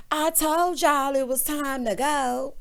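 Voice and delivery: drawn-out mocking imitation; mimicked housewife. [name] chiding the group on the bus, slow mock singsong.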